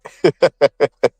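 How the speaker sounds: short string of high chuckles. Real, not acted. Joking with the panel about credit, amused chuckle. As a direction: chuckle at your own remark. Amused after his own remark.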